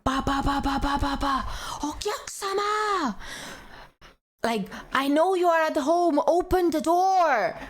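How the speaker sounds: high mock shouting at the door; bang-bang then high insistent calling; imitated pounding and calling. Imitating the collector banging on her door, high mock calling. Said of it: "it's like".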